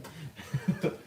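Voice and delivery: short high chuckle; real amused giggle; laughing. Real, not acted. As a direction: let out a quick high laugh. Laughs after cheering himself on.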